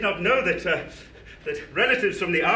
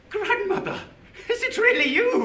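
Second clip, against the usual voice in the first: high, stunned gasp of a question; asking a ghost if she's real; startled disbelief; 11 semitones higher than usual. Acted. Scrooge sees dead grandmother.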